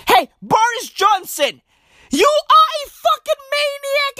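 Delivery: high-pitched, exclaiming call-out; mock outraged shout. Host calling out Boris Johnson, high mock-outraged shout. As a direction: climb into a high, incredulous yell.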